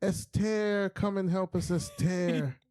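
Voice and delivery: high pleading call for Esther; high-pitched mock plea for help; imitating Jada. Friend voicing Jada begging Esther to help, high mock plea. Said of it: "She was like".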